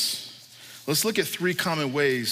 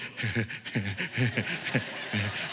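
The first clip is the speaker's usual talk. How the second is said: low, soft huffing giggle; imitated baby giggle; 6 semitones lower, 4 dB quieter than usual. Dad imitating his excited baby, giggling. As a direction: mimic an excited wobbly baby's chuckle. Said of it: "He's all like".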